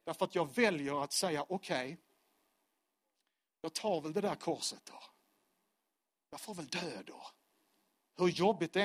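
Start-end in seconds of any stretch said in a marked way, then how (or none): 1.5-7.3 reluctant surrender. Acted. Acted reluctant surrender to the cross.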